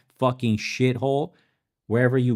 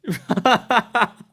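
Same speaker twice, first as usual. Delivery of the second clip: high burst of laughter; amused cackle; 11 semitones higher than usual. Real, not acted. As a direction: crack up at yourself. Laughing at own slip.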